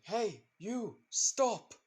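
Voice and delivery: high, loud shout to halt someone; yelling. A narrator's reading. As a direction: yell out sharply to stop them. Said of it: "a man that yells out to someone".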